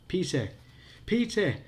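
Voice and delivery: high, loud cries across the graveyard; desperate calling. A narrator's reading. Josh calls the lost dog in the dark, high desperate shouts. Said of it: "Josh called, sounding shrill and desperate".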